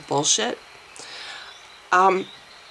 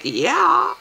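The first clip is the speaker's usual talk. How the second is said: eager yes; 9 semitones higher than usual. Retold or imitated. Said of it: "I'm like".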